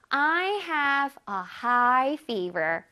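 very slow, careful English; slow teacher demo; slow model reading. Shown as a demonstration. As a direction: read it out slowly for the class. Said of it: "저 고열이 있어요".

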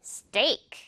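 스테이크 as English steak with a hard ㄸ-like t; one intense syllable for 스테이크, no puff on the t; tense-t steak. Shown as a demonstration. Teacher stressing the close s and t, tense-t steak. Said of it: "진하게, 뜨겁게".

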